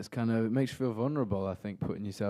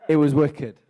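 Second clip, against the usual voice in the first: laughing; 6 semitones higher, 12 dB louder than usual. Real, not acted. Laughing answer to repeat question.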